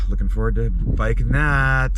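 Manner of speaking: road noise. Real, not acted. Recorded from moving vehicle.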